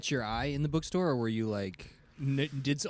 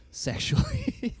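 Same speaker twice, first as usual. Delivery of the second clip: slow, breathy seductive whisper, much higher; ASMR voice; much slower than usual. Pretend. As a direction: purr it slowly and suggestively. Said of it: "our A S M R voice".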